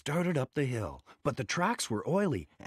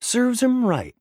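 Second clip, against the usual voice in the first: gloating; 12 semitones higher, 8 dB louder than usual. A narrator's reading. Diesel gloating over Thomas.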